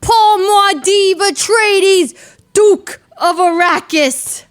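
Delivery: slow, loud, grand herald voice; epic proclamation. Pretend. Streamer hailing his Dune hero, slow grand proclamation. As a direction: announce him like a royal herald, drawn out.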